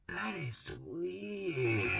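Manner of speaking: drawn-out, very soft and slow, in mom's voice; mom's slow ominous "That is weird". Retold or imitated. Mom judging her daughter's symptoms, slow hushed mock voice. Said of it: "my mom go like this, and she'd go".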